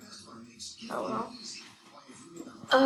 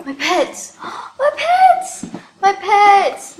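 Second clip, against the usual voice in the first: gasp, then squeal higher and louder; overjoyed squeals; 8 semitones higher, 10 dB louder, much slower than usual. Real, not acted. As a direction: build to a high, loud shriek of delight. Spots her old pets, overjoyed.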